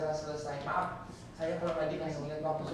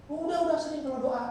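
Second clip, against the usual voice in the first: dismissive quote; 7 semitones higher, 5 dB louder, faster than usual. Retold or imitated. Retelling the woman's dismissive reply.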